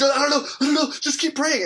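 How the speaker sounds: high, loud re-enacted panicked plea; retold panic. Husband begging wife to keep praying, re-enacted panic.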